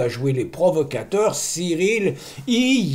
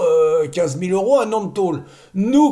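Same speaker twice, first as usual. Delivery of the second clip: ordinary talk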